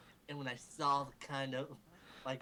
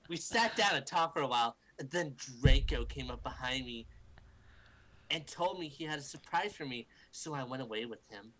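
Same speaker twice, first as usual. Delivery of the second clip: usual speaking voice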